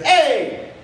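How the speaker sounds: high sudden mock shout of hey; a high startled hey; raccoon yelling hey. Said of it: "There's like".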